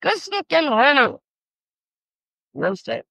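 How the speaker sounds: ordinary delivery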